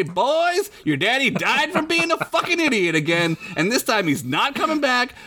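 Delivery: scolding mother voice; mama voice. Retold or imitated. Voicing the mama.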